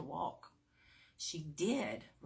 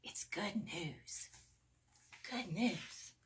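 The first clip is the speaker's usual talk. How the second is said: whispered aside; 4 dB quieter, slower than usual. Real, not acted. Reader whispering good news to the listening children. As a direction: share it like a secret.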